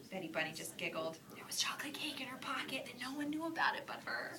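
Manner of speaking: soft secretive whisper; whispered narration. A narrator's reading. Narrator whispering a secret to the listener. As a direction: tell it as a hushed secret. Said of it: "whispered secret narration".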